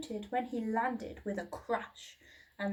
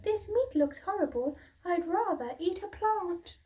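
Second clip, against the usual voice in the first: much higher small apologetic voice; timid little dinosaur; 8 semitones higher, 4 dB louder than usual. A narrator's reading. Little dinosaur apologising to his parents, high and small. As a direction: squeak it out like a shy hatchling.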